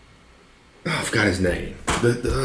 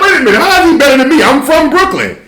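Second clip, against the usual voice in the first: fast, higher indignant complaint; indignant protest; 13 semitones higher than usual. Retold or imitated. Actor protesting to the director, fast and indignant. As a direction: protest like you've been robbed of the part.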